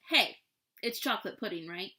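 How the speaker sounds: plain reading voice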